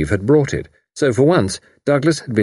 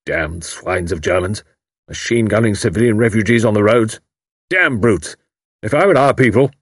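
mild fire-eating rant at the paper; lightly voiced indignant old major; blustering major. A narrator's reading. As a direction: grumble over the newspaper, rising. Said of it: "uttering appropriate comments aloud".

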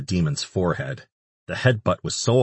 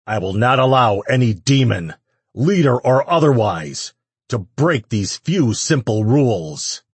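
stern decree; 6 semitones higher, 6 dB louder than usual. A narrator's reading. Decree to Hell's crowd.

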